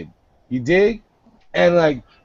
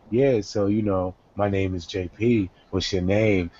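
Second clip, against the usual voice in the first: much lower, softer chat-up voice; low quiet voice; 10 semitones lower, 7 dB quieter than usual. Retold or imitated. Introducing himself to a girl, low and soft. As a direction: drop the voice low and quiet to seem harmless. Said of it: "I always throw on the".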